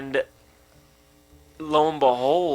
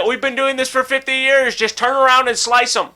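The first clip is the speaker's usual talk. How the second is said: high, urgent imitation of pursuers; playful battle cry, pitched way up; mock shouting; 8 semitones higher than usual. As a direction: call out orders in a high pretend shout. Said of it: "They're probably shouting".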